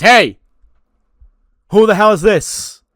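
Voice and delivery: loud, high indignant shout; angry outburst. Retold or imitated. Johnny shouting at Danhausen, loud and high. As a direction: yell at the intruder.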